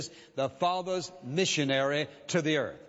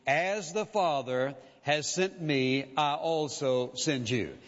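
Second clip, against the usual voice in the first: slow scripture quote; slower than usual. Acted. Emphatic scripture quote in sermon.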